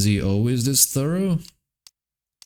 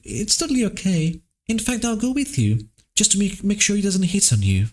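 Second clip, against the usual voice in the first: higher, lightly voiced teasing girl; 11 semitones higher than usual. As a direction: play the cheeky friend, pitched up. Rereads line in character voice.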